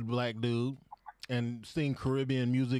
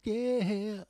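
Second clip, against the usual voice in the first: high, louder, slow falsetto; high falsetto echo; 13 semitones higher, 4 dB louder, much slower than usual. Pretend. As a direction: sing it out high and pleased.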